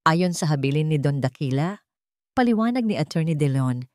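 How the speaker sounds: plain reading voice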